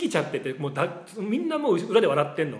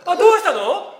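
much higher, louder, laughing mom's greeting; mom's raised phone voice; 12 semitones higher, 8 dB louder than usual. Retold or imitated. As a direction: play the happy mother picking up the phone. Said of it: "ってもうワントーン上がってるもん".

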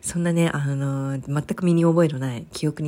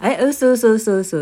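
loud, rising panicked denial; can't believe it; shocked disbelief; 4 semitones higher, 7 dB louder, slower than usual. Real, not acted.

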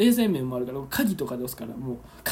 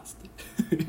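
ordinary delivery

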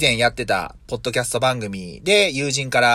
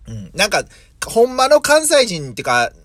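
usual speaking voice

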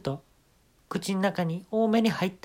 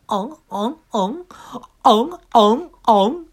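moans building much louder and higher; 8 semitones higher, 10 dB louder than usual. Retold or imitated. Comedian moaning louder in the second verse, moans building to a wail. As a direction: swell from moan to wail. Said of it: "二番入ります".

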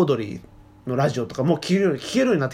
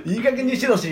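Gunma dialect scold; 8 semitones higher than usual. Retold or imitated. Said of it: "みたいな感じでさ".

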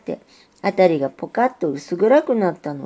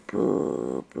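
low, soft, slow ブー; faked groan; 9 dB quieter, much slower than usual. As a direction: fake a humming to cover up. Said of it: "ブーとうなってごまかしておりました".

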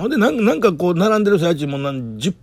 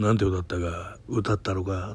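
Takakura Ken style; 6 semitones lower, 10 dB quieter than usual. Retold or imitated. The boss asking what he sang, in a lightly voiced Takakura Ken manner. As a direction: play it like a grave movie scene, lightly. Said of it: "ほんま高倉健、映画のワンシーンみたいな".

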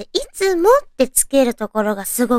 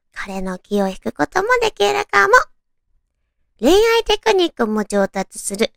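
ordinary talk, nothing marked

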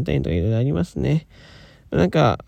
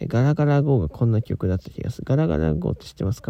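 ordinary talk, nothing marked

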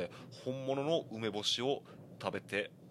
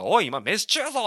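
loud, higher, indignant telling-off; retold angry shout; 10 semitones higher, 10 dB louder than usual. Salaryman yells at the old man mid-meal, angry. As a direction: snap back hard at the old man. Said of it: "って怒ってて".

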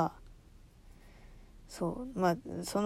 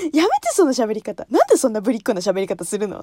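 annoyed mother; 6 dB louder than usual. Retold or imitated. Mother scolding the daughter's cutesy Minnie voice. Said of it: "って言って".

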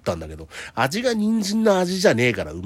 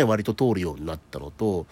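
usual speaking voice

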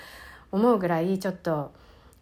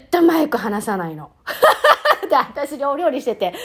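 laughing; 4 semitones higher, 3 dB louder than usual. Real, not acted. Amused by child hogging the mic.

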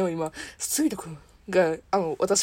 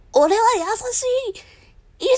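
Chopper impression; 12 semitones higher, 3 dB louder than usual. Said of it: "チョッパー".

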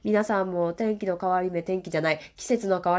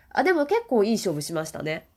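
usual speaking voice

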